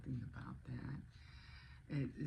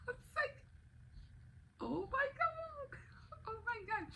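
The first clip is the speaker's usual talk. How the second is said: happy crying. Real, not acted. Mom reliving her son's spelling score, sobbing with joy. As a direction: sob it out, overwhelmed with joy.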